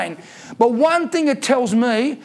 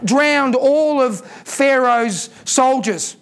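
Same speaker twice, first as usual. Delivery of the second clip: slow emphasis; much slower than usual. Acted. Preacher slows for emphasis.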